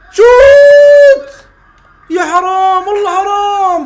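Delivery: very high, loud, drawn-out scream to shoot; urging yell. Real, not acted. Fan urging a shot then groaning at the miss, loud yell.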